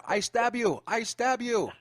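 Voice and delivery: high, loud mock yell; retold yelling. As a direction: shout the threat as the retold husband. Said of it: "he's yelling out at her".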